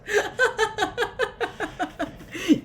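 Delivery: high helpless laughter; can't stop laughing; long squealing laugh fit. Real, not acted.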